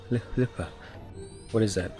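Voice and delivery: ordinary talk, nothing marked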